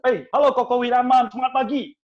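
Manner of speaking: high, loud and bright hello; cheery greeting. Retold or imitated. Salesman greeting a client loudly and cheerfully. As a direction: greet him full of energy.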